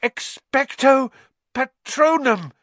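high, loud, slow gasping cry; gasped cry. A narrator's reading. Harry nearly overcome, gasping cry. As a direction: gasp the spell out with effort. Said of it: "he gasped".

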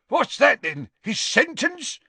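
high voice rising in anger; rising angrily. Acted. Father questioning the official's scroll, angrily rising. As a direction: demand it with mounting temper. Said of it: "his voice rising angrily".

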